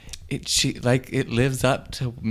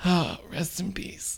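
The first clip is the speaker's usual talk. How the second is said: slow hushed whisper; whispered farewell; 5 semitones higher, 7 dB quieter, slower than usual. Acted. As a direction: whisper it slowly, solemnly. Toast to dead sister.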